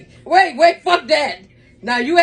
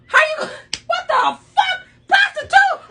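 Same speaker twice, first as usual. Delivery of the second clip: much higher, shouted, rising in disbelief then laughing; shocked outcry; 11 semitones higher than usual. Real, not acted. Mother repeats the essay's claim in a shocked, high shout. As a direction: shriek the word in disbelief.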